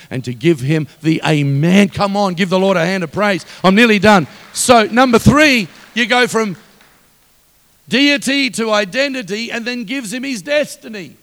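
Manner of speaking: loud rallying shout to the congregation; full-force preaching. Real, not acted. Preacher calling the church to give praise, full-force preaching. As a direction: belt it out, urging them to praise.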